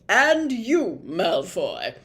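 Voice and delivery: loud, pointed prefect voice; single him out sharply; officious prefect; bossy prefect. A narrator's reading.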